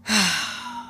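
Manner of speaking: long, soft exhaled sigh; deflated sigh. Real, not acted. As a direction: let out a soft, resigned sigh. Deflated after his rejection of her.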